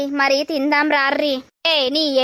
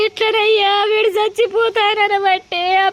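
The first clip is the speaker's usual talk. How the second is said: wailing; 5 semitones higher than usual. Acted. Mother wails over son.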